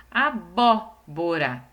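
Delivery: "Abóbora" very slow, the "bó" punched and held; teacher sounding out "Abóbora" by its strong syllable; stress on BÓ. Shown as a demonstration. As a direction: punch "bó" in "Abóbora", then pause. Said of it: "essa sílaba é a mais forte".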